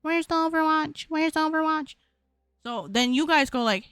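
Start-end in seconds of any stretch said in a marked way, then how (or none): 0.0-1.9 higher, monotone pestering chant; mock viewer. Streamer imitating a viewer who missed the news, mock pestering. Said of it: "there's gonna be somebody that's like".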